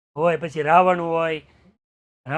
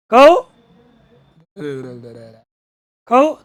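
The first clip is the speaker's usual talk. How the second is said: loud, higher taunting shouts; 3 semitones higher, 9 dB louder than usual. Retold or imitated. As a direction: goad him loudly. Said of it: "villain taunting priest".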